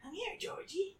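put-on high sing-song lure to a child; creepy clown call. Luring little Georgie with a high clown voice. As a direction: coax like a clown luring a kid. Quoting the movie's villain.

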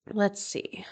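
soft unvoiced whisper to herself; whispered aside. Real, not acted. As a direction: breathe it out under your breath. Aside while finding article.

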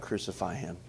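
low, soft echo of a cry; subdued quote. Retold or imitated. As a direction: say the cry quietly, as a sober question. Same cry turned on the congregation.

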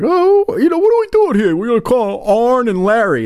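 high, exaggerated fan voice; squeaky mocking fan voice; Mikey voice. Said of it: "He's like".

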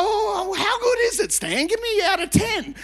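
high, eager, playfully needy; mock delight. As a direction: squeal with hammed-up delight, fishing for praise. Acts out fishing for praise.

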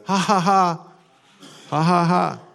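loud, high, deliberate "ha ha ha"; hammed-up belly laugh on demand; forced mock laughter. Shown as a demonstration. Preacher choosing laughter over moping, forced laugh. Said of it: "I'm gonna do the".

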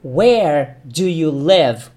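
teacher model, slow and clear; model phrase. Shown as a demonstration. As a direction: say "Where do you live?" slowly for repetition.

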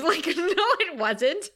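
high, breaking into laughter; laughing. Real, not acted. Podcaster laughing at the smug Watcher. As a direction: laugh it out, pitch flying up.

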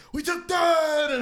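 high, loud, stretched-out celebration; triumphant fan shout. Retold or imitated. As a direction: imitate a fan yelling in triumph, drawn out slowly. Imitates fan celebrating the steal.

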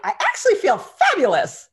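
high, loud, delighted announcement; exuberant boast. Retold or imitated. Meat-eater answering friends about her stomach, exuberant boast. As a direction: belt it out with relish.

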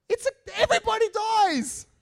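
put-on very high, loud, slow Indian accent rant. Coworker outraged about the flood story, Indian accent. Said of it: "he was really throwing his arms around".

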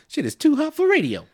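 very high, loud mock squeal. Mocking voice squealing.

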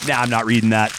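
imitated radio host's offhand refusal; Jim Rome. Radio host rejecting a listener's email, imitated deadpan aside. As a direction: dismiss it after reading silently. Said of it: "reading something to himself 'cause he'd pause".

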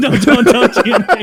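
high, loud laughing protest. Real, not acted. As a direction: laugh through it. Laughing at the joke.

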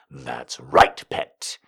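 low, quiet teasing. A narrator's reading. Don teases his struggling pet, low and quiet. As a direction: taunt her softly from low down. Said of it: "he said as he teased".

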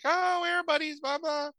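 very high fake cheering; mock crowd cheer. Crowd at airport greets buddies, mock cheering. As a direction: imitate adoring fans in a squeal.

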